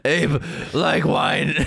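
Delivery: slow, dumb ape speech; caveman ape voice. Pretend. Slow ape talk joke.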